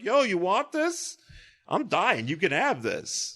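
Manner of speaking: high, slow, fading alien voice; dying alien voice. Retold or imitated. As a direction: hand it over like a dying alien. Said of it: "dead alien was like".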